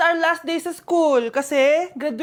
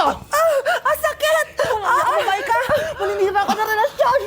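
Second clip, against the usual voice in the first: pained yelp; 8 semitones higher than usual. Acted. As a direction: yelp as if hair is pulled. Hair-pulling fight pain.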